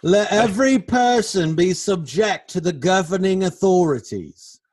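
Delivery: high, loud scripture declamation; mock proclamation. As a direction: proclaim the verse with mock grandeur.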